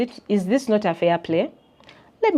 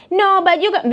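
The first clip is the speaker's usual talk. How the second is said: mock protest; 12 semitones higher, 6 dB louder than usual. Voicing client protest.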